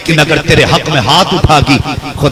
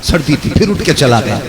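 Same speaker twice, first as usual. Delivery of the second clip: normal talking voice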